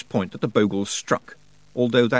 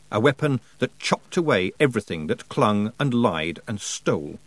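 ordinary delivery, nothing marked

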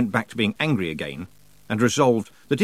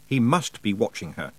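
usual speaking voice

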